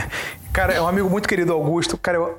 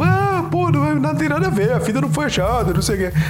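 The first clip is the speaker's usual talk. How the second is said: mock a dismissive youtuber; 8 semitones higher than usual. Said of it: "um youtuber cuzão falando".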